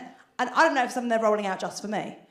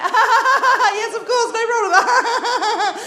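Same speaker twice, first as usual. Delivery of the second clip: much higher, slow strained laugh through the words; forced cheerful yes; 13 semitones higher, 8 dB louder, much slower than usual. Retold or imitated. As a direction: agree while clearly panicking. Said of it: "you've got to go".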